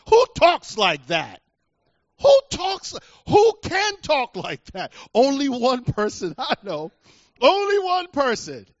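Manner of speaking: loud, high, exclaiming preacher; full-force preaching. Real, not acted. Preacher marvels at God's words before the congregation, shouting high. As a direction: cry it out in awe to the congregation.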